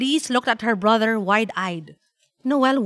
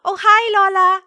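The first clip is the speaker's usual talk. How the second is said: put-on high, loud, excited child; delighted little girl on the phone; child's greeting; 10 semitones higher, 7 dB louder than usual. A narrator's reading. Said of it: "greeted Feliz".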